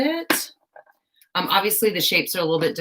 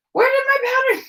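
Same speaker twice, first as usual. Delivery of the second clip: where did my pattern squeaked much higher and louder; comic falsetto panic; squeaky high voice; 5 dB louder than usual. Pretend. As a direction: squeak it like a flustered cartoon mouse. Searching for lost pattern.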